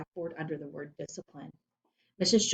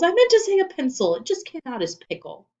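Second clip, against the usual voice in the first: loud, high outburst that eases off; exasperated snapping; 11 semitones higher, 10 dB louder than usual. A narrator's reading. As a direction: protest the slip, indignant and high. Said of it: "snapped Missus Jules".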